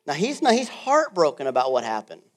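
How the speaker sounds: loud, high preaching build; fired-up preaching. Acted. Preacher rousing the church: loud, high, fired up. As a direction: drive it home to the congregation.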